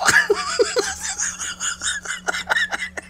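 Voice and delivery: loud, teary fit of laughter; helpless cackling; hysterical laugh. Real, not acted. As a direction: laugh till you cry.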